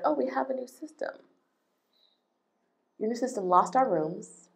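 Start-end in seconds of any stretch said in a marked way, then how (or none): 0.0-1.1 high, airy brush-off voice; mock dismissive staff. Imitating hotel staff brushing off guests. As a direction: mimic the staff shrugging it off. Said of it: "you guys are like".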